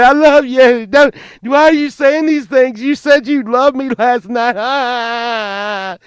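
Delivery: high, loud lovesick whine; hammed-up heartbroken whine; heartbroken plea. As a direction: whine it like a jilted lover. [name] pleading heartbroken.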